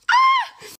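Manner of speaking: sudden very high, loud fangirl squeal; short ecstatic scream; excited squeal. Real, not acted. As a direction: shriek with delight. Excited about Katie Fang product.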